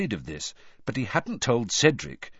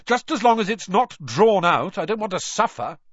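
high, raised sarcastic rant; losing temper; 9 semitones higher, 5 dB louder than usual. A narrator's reading. Harry mocking his predicted death, heated and sarcastic. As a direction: keep ranting loudly, pitched high. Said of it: "his temper getting the better of him".

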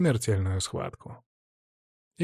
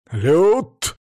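thundering call, higher and louder; booming voice from sky; 6 semitones higher, 7 dB louder than usual. A narrator's reading. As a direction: boom the name across the hall. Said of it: "прогремел голос Владимира".

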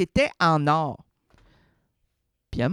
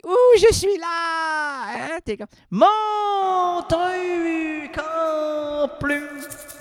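cri excité; 11 semitones higher, much slower than usual. Pretend. Imite un feu d'artifice.

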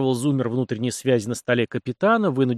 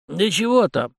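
bashfully waving off thanks, pitched up; higher-pitched, mildly embarrassed modesty; 7 semitones higher, 3 dB louder, slower than usual. A narrator's reading. Helper thanked by the captain, embarrassed. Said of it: "смутился тот".

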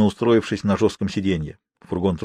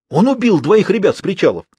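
breathless, urgent and high; alarmed outburst; 8 semitones higher, 3 dB louder than usual. Acted. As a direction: blurt it out in panic. Panting henchman blurts news.

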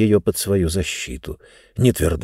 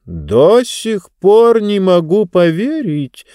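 high, slow and sorrowful; sad book voice; 10 semitones higher, 4 dB louder, slower than usual. Acted. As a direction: lament it, slowly, higher up. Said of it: "печальный голос книги".

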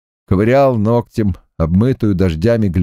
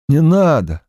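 gasping for breath; 6 semitones higher than usual. Acted. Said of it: "он еле смог закончить фразу".

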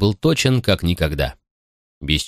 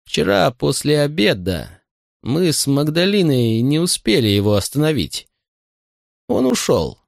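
higher, measured servant; 6 semitones higher than usual. Acted. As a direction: choose each word carefully. Butler voice, slow.

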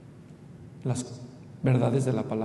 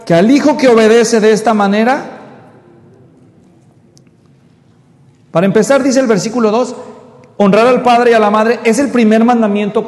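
full-force preaching; 7 semitones higher, 11 dB louder than usual. Acted. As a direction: thunder the scripture to the congregation.

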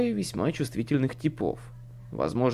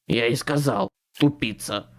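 irritable man's grumble; grumbled retort; 3 semitones lower than usual. A narrator's reading. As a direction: grumble an insult at a fool. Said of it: "буркнул Туз".

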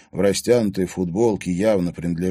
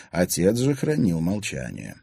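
ordinary delivery, nothing marked

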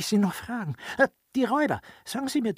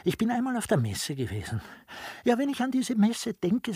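ordinary talk, nothing marked

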